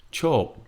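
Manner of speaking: Cho starts high and falls; falling tone. Shown as a demonstration. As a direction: let Cho drop from high. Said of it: "is falling".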